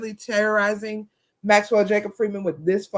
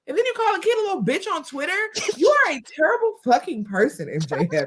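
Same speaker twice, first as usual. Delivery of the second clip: mock scolding; 12 semitones higher than usual. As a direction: scold the absent villain in exaggerated indignation. Mock scolding of absent wrestler.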